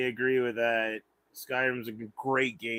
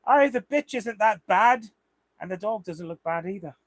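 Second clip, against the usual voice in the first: lightly voiced gnome taunt, a bit higher; a hint of a cheeky gnome; quoting the gnome; 5 semitones higher than usual. Gamer quoting a gnome's taunt to his viewers, mildly put-on voice. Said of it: "what's the one he says".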